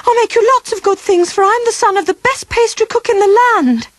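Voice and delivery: high, stammering plea for his life; terrified boy pleading, voice pitched far up; frightened pleading. Acted. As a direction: beg the beast not to eat you, tripping on the words. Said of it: "cried Sam".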